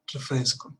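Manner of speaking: normal talking voice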